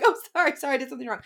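high, laughing over-apologetic sorry; mock apology, laughing. Mocking how women over-apologize, high and laughing. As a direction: laugh while playing the eager apologizer. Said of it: "we're like".

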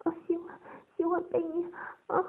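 high, halting gasped answer; faltering reply. A narrator's reading. Coerced, halting reply in story.

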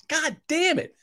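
high, strained muttered curse; exasperated curse. Retold or imitated. Customer charged twice by the app, strained and fed up. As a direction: sound fed up beyond words. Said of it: "I'm like".

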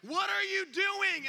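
high, loud, slow yell; wife screaming. Retold or imitated. Wife bursting in on the mess, loud yell. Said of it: "She starts screaming at me".

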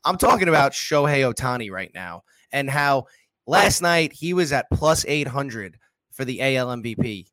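normal talking voice

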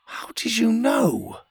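high and slow, astonished; surprised. A narrator's reading. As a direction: ask in wonder. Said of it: "Pocket said with surprise".

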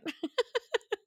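high, breathy burst of real laughter; sudden high giggle fit; laughing hard. Real, not acted. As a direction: crack up helplessly. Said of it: "that's why I'm laughing so hard".

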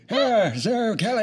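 lightly voiced elderly man; old man voice. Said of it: "that's gonna sound really good".

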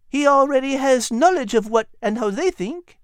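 high, small dog voice with a French touch; a tiny French dog explaining; high chatty dog voice; little French dog. A narrator's reading. Liz explains.

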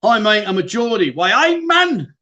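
hammed-up Geordie, last words high and drawn out; bad Geordie accent. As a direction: put on a terrible Geordie accent. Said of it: "That's probably the worst Geordie accent".

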